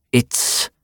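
unvoiced, clipped German accent. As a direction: start the line in a German accent. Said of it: "chewed the words in his German accent".

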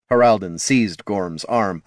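plain reading voice

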